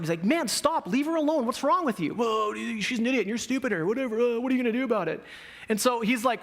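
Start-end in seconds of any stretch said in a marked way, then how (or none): 0.2-2.1 high-pitched exasperated protest; frustrated. Retold or imitated. Buddy confronting the bus bully, frustrated. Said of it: "like, really getting frustrated". 2.1-5.2 fast, higher-pitched mock bully; bully's retort. Bully talking back to buddy on the bus, mocking.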